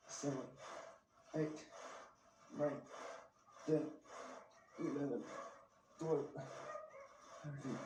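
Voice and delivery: counting reps under strain, pushing out each number; straining rep count mid-set. Real, not acted. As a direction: grunt the count through heavy lifting. Counting reps during heavy set.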